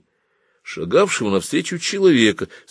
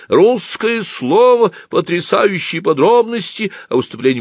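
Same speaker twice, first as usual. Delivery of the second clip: high, loud newspaper hawker; thin, creaky yell; 12 semitones higher, 5 dB louder than usual. A narrator's reading. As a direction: cry the headlines in a thin, creaky voice. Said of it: "заорал тонким скрипучим голосом".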